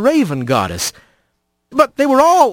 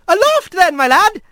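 put-on young otter bosun; 13 semitones higher than usual. Young otter orders Rat.

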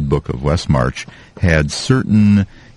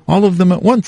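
much higher, eager hobbit voice; cheerful hobbit; 3 dB louder, faster than usual. Acted. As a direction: blurt it out high and bright. Bilbo answers cheerily.

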